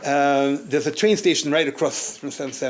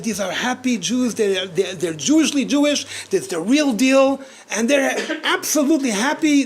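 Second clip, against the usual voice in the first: higher, louder, slow and awed; excited; 8 semitones higher, 5 dB louder, slower than usual. Retold or imitated. Retelling Andy's awe at the families, excited. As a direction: marvel at it, overjoyed. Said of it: "he was very excited".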